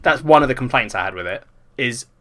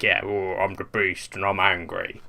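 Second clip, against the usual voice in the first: lower, slower beast voice; a slow low mock monster; 5 semitones lower, 6 dB quieter, slower than usual. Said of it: "the Beast's voice is deeper".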